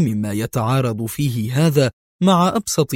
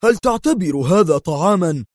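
higher, outraged disbelief; Qadri's incredulous cry at the hospital menu; indignant exclamation; 6 semitones higher than usual. A narrator's reading. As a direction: protest the meagre meal in disbelief. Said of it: "فهتف قدري".

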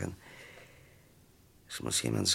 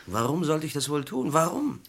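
desperate protest; 10 semitones higher than usual. Acted. Accused man protests his innocence.

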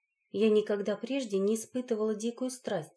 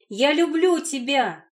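loud, high, drawn-out declaration of love; acted shout; 6 semitones higher, 8 dB louder, slower than usual. A narrator's reading. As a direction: cry it out slowly at full voice. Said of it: "закричала я, что было сил". (громкий, протяжный выкрик)

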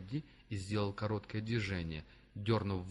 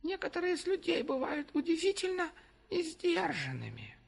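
squeaky creature voice. A narrator's reading. Gefar continues his apology.

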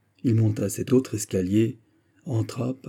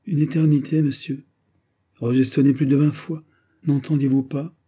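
higher voice, pushing the complaint; reproachful, with emphatic bursts; agitated reproach; 5 semitones higher, 4 dB louder than usual. Acted. The marquise who rang twenty times scolds Fouquet, reproachful.

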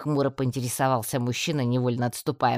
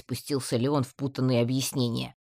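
ordinary delivery, nothing marked

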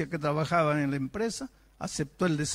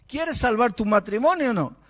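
forceful challenge; 8 semitones higher, 7 dB louder than usual. Pretend. Elder confronting the atheist.

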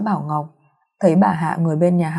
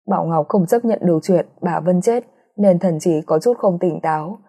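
usual speaking voice

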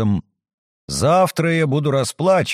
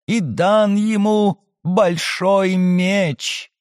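slow, higher apocalyptic chant; eerie prophetic voice; 5 semitones higher, slower than usual. Acted. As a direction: intone it like a prophet. Possessed girl recites Revelation.

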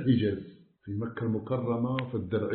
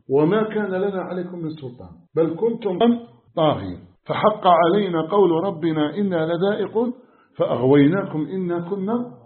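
loud impassioned sermon, pressing hard; preaching at full force; 3 semitones higher, 5 dB louder than usual. Acted. Preacher warning the congregation of Judgment Day, loud and impassioned.

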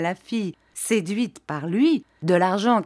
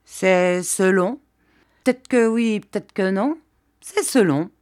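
wary evasive reply; 2 semitones higher, 4 dB louder than usual. A narrator's reading. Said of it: "d'une voix plus vive, mise en défiance".

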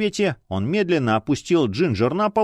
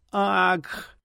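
pained gasp; 6 semitones higher, 4 dB quieter than usual. A narrator's reading. Wife stabbed, gasps.

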